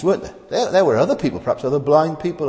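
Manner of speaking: usual speaking voice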